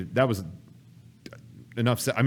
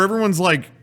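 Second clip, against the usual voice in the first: ordinary delivery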